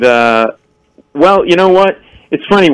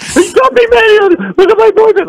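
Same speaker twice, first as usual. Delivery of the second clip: very high hysterical mimicry; mock screaming. Wounded man and girlfriend amid police, mock screaming. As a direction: imitate wailing bar-fight victims. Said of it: "Like, scream".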